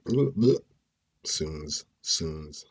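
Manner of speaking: slurring and stumbling over words; stoned. Real, not acted. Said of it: "that weed is kicking in".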